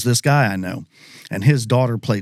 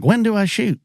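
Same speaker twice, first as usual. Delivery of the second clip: put-on high-pitched eager kid asking; retold kid's question; 11 semitones higher than usual. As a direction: pitch it way up like a kid awaiting the word. Eager kid in retold hunting story.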